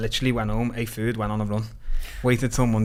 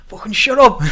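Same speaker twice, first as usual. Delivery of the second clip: a higher, louder joyful yell; excited yell; 12 semitones higher, 9 dB louder than usual. Retold or imitated. Family yelling at the not-guilty verdict, joyful shout. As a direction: shout it in disbelief at the win. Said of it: "They just, like".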